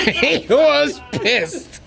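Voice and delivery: he was pissed, slowly through laughter; slow laughing retelling. Real, not acted.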